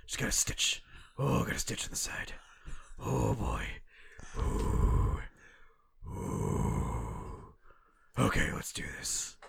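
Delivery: voiceless puffing with long exhales; comically exhausted hero; winded Batman. Acted. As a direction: gasp it out as if nursing a stitch. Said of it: "you need some oxygen, Batman".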